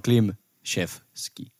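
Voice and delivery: Klimczewski slowly, as "klim... shef... ski"; demonstrated pronunciation. Shown as a demonstration. Correcting friends on own surname, slow pronunciation demo. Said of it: "miten sun sukunimi lausutaan".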